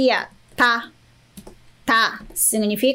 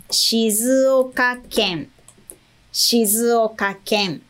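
slow model word; slower than usual. Shown as a demonstration. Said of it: "eu sou de Shizuoka".

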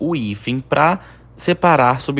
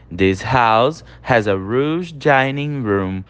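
slow model reading; 2 semitones lower, much slower than usual. Shown as a demonstration. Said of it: "Essa casa tem uma sala de jantar enorme".

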